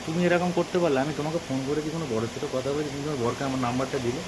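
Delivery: ordinary talk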